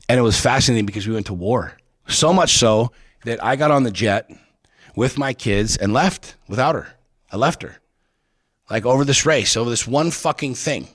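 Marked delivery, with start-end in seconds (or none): none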